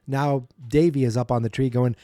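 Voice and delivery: ordinary delivery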